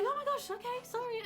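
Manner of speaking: retold startled oh my gosh; alarmed apology. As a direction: recreate the panicked sorry. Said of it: "we were like".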